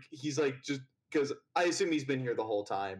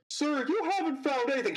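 timid assistant; 13 semitones higher, 3 dB louder than usual. Acted. Meek assistant interrupts.

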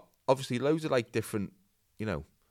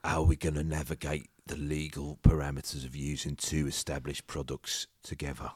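low Cockney business grilling; deep, measured Cockney questioning; put-on Cockney dragon; Cockney investor; 7 semitones lower, 3 dB quieter than usual. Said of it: "Oh, he's Cockney".

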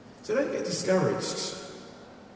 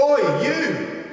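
much higher, insistent yell; shouted call; 9 semitones higher than usual. Retold or imitated. The lad calling the speaker back, high insistent yell. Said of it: "and he said".

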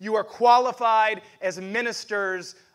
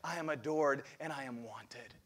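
tender declaration; 6 semitones lower, 10 dB quieter than usual. Shown as a demonstration. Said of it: "lay your hand on your heart right now and say".

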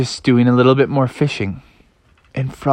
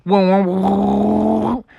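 slow, higher drawn-out whoas; Froggy surprised; 5 semitones higher, much slower than usual. Acted. Froggy feeling the fish tug, slow amazed whoas. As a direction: let the whoas stretch out, amazed. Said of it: "He's like".

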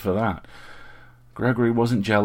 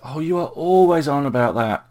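high, loud theatrical protest; sighing exasperation; 7 semitones higher, 7 dB louder than usual. A narrator's reading. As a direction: complain with a big playful huff. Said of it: "Richard sighed with a woof".

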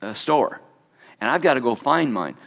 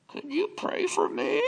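tiny, much higher, soft little voice; 7 dB quieter than usual. Retold or imitated. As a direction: ask like a small shy voice behind the shelf. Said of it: "this little voice going".

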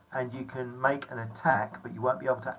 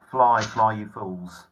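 Gandalf quote; 7 dB louder, slower than usual. Acted.

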